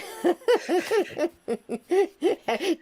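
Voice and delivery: real high laugh, cracking up; burst of laughter. Real, not acted. Storyteller losing it over her own joke, laughing hard. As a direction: let a genuine laugh take over.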